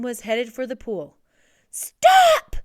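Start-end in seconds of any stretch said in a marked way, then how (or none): 1.7-2.6 very high, loud shout; desperate cry. A narrator's reading. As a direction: cry out to halt everyone at once. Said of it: "cried Lewis".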